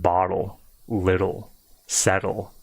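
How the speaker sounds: bottle, little, settle with a soft D for the T. Shown as a demonstration. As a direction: soften the double T to a light D. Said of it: "they almost sound like a soft D".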